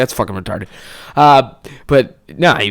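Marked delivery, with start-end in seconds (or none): 0.0-0.7 dismissive dad. Retold or imitated. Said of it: "he's like".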